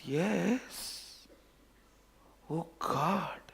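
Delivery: breathless awed whisper; shocked whisper. Acted. Seeing himself in the water, he whispers in shock. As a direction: whisper it in disbelief.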